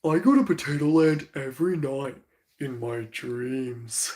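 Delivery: dopey cartoon dog voice dropping low and slow; Goofy voice fading to a low slow end; Goofy quote. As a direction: drawl it dreamily like the goofy dog. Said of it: "one Goofy quote ... where he's like".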